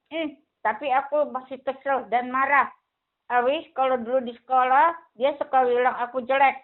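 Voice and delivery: put-on quick, mildly cross little boy; the puppet kid grumbling, fast; hint of an annoyed puppet boy; lightly voiced cross child puppet. Puppet Billy complains.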